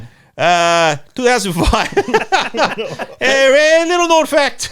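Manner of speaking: put-on laughing, high Boston accent; goofy Boston accent with a laugh. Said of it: "Do it in a Boston accent".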